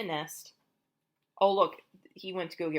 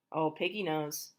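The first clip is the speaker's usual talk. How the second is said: ordinary delivery, nothing marked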